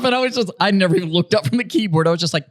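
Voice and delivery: ordinary delivery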